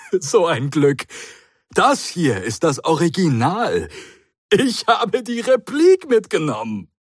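bubbling over with delight; overjoyed Doctor. Acted. The Doctor tells Matthews the good news, elated and high.

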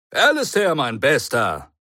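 put-on big, high, loud hail of an old friend; booming greeting. A narrator's reading. The Doctor greets the Brigadier, booming loudly. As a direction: call out the name with gusto. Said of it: "posaunte der Doktor".

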